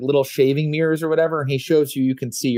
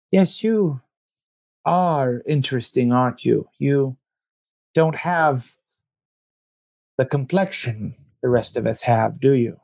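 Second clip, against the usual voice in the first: a hint of a courtly vampire, intrigued; lightly voiced vampire. Vampire appraises the newcomer.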